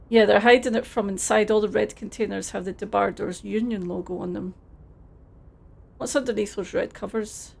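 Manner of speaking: ordinary delivery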